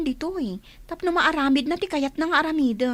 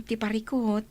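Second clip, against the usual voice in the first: ordinary delivery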